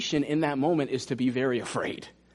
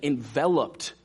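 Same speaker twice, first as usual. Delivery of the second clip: ordinary talk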